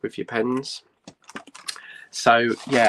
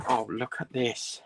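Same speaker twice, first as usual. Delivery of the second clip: ordinary talk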